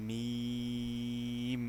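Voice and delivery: মিম held very long, lips closed together; Arabic mim demo. Shown as a demonstration. Teacher models মিম for students, slowly held. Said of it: "মিমটা উচ্চারণ করব".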